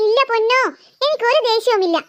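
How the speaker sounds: ordinary delivery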